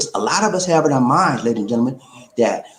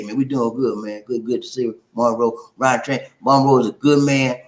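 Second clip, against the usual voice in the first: ordinary talk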